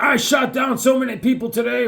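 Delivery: high-pitched mock boasting shout; bragging. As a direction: crow like a loudmouthed comic-book braggart. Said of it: "He's bragging".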